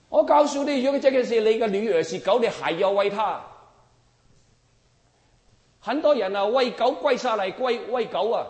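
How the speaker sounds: high, loud driving point; loud preaching. Real, not acted. Preacher tells of people kneeling to feed dogs, loud. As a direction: hammer the image home.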